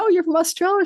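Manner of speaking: high-pitched, delighted recognition; surprised question. Retold or imitated. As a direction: retell it as a high, eager question. Retells her surprised question to cashier.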